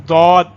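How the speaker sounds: ضاد said loud, with a heavy d and long vowel; original pronunciation. Shown as a demonstration. Teacher gives the letter's original articulation, emphatic heavy ضاد. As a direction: model ضاد firmly, thick d, drawn out. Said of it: "اصل pronunciation ہے".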